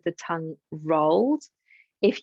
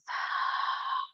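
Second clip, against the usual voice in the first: exhale through the mouth; 8 dB quieter than usual. Shown as a demonstration. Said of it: "maybe an exhale through the mouth".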